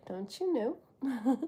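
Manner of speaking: "Don't you know?" slow and soft, each word stretched; slow, teasing catchphrase delivery; drawn-out catchphrase. Shown as a demonstration. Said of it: "I'm gonna be saying that a lot".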